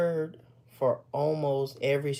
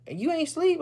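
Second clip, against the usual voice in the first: much higher-pitched imitation of wife's question; surprised wife; 14 semitones higher than usual. Wife asks husband if he's awake, high and surprised. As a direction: quote her, pitched way up in disbelief.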